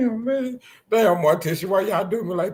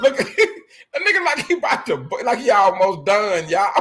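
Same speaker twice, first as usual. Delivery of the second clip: words squeezed out through laughter; can barely talk for laughing; laughing hard; 6 semitones higher, 4 dB louder than usual. Real, not acted. Laughing at his boy on the live video.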